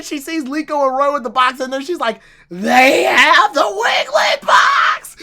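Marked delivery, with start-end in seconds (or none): 2.5-5.2 slow, high, hammed-up shout; imitated meltdown, loud and high; mock losing it. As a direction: stretch each word into an outraged mock yell. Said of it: "losing her mind".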